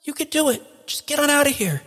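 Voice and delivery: very high, small pleading voice; thin high cry of encouragement; urgent voice from a vat. Acted. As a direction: urge a friend to flee, from a distance. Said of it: "a voice from a vat behind you".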